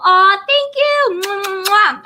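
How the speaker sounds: high, slow, gushing thank-you with air kisses; touched thank-you. Real, not acted. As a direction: coo your thanks to the viewer. Thanking a chatter sweetly.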